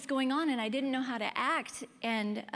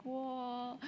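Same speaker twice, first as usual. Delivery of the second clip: movie-style whoa; 2 semitones higher, 6 dB quieter than usual. Retold or imitated. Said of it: "in the movies where everything's like".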